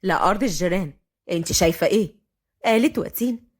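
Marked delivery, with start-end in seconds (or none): 0.0-2.2 low, dry male sarcasm; mock the obvious question in a low voice; sarcastic. A narrator's reading. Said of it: "فهد بسخرية". (بسخرية)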